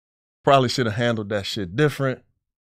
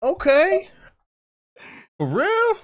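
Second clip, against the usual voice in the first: pleased surprise; 4 dB louder, slower than usual. Retold or imitated. His delighted reaction.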